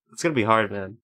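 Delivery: ordinary delivery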